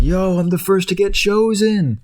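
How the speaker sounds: high, excited brag; can't believe his luck; thrilled potato. Retold or imitated. The potato is picked first, high and thrilled. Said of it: "and it's like".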